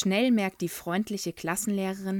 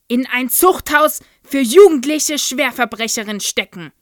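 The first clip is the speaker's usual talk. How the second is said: loud, higher furious tirade; raging tirade; 4 semitones higher, 12 dB louder than usual. A narrator's reading. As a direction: build the threats into a frenzy. Headmistress rages at pupil.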